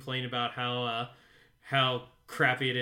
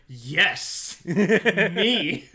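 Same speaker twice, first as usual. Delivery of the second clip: smug retort; 3 semitones higher, 7 dB louder than usual. Retold or imitated. Imagined Angel reply.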